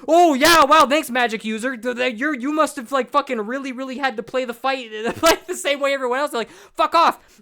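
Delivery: sarcastic outburst. Real, not acted. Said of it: "sarcastic retort continues".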